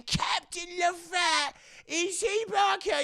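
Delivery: put-on high, slow old rat voice; doddering, astonished old pirate; hammed-up ancient squeal; ancient cabin boy.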